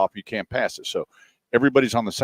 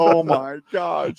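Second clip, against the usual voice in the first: very slow, higher drawn-out amazement; stunned; 8 semitones higher, much slower than usual. Retold or imitated. Teller reacting to the old lady, slow amazed. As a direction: let the shock stretch out. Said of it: "I was like".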